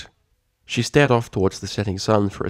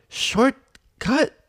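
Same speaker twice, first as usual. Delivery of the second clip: sputtered shortcut. Acted. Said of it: "Applebloom's voice sputtered".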